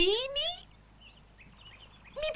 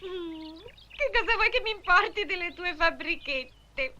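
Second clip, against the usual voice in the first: normal talking voice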